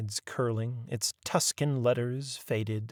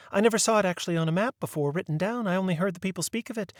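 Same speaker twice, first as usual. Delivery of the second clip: higher, lightly voiced unhappy spinster; a shy lady wincing at the topic; timid friend; 8 semitones higher, faster than usual. A narrator's reading. Birdie explains quickly.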